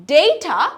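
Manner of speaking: Data as "DAY-ta"; Data, first syllable rhyming with day; American variant. Shown as a demonstration. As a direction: say Data with an "ay" vowel. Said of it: "দুটোই কিন্তু correct".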